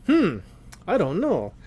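ordinary talk

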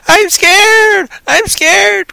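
high, loud imitation of an overacting actress; hammed-up shriek of fear; mock scared scream. Reviewer mimics the screaming blind character, mock scared scream. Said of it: "All she does is scream".

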